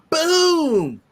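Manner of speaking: high, loud "boom" stretched out and falling; drawn-out boom. Real, not acted. Host hyping the show's merch, booming exclamation. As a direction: punch out a long, high boom.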